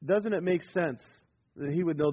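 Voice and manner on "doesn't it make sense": normal talking voice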